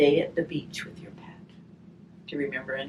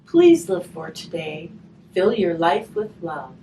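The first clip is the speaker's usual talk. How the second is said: gentle storybook reading, slow on the rhymes; reading to children; 4 semitones higher, 7 dB louder than usual. Acted. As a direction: read the rhyme aloud to the children. Reading a rhymed picture book aloud.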